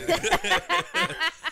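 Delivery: loud high-pitched burst of laughter. Real, not acted. Big laugh.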